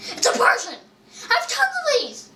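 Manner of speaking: high-pitched, rushed complaint; exasperated outburst. Real, not acted. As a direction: burst out in dismay at yet another duplicate. Exasperated at getting another duplicate.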